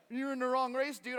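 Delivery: loud, high mock repeat; mocking echo. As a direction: throw his words back at him. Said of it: "And I was like".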